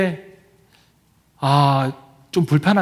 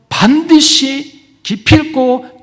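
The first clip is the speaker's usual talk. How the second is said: 반드시 stretched very slowly, much higher and louder, then 기필코 punched; emphatic stress; 9 semitones higher, 7 dB louder, much slower than usual. Acted. Preacher proclaiming the covenant words to the congregation, drawn out and loud. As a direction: hammer each word like an oath.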